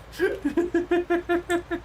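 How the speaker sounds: high, bubbling laugh; burst of laughter. Real, not acted. Laughing at her own story of the Canadians.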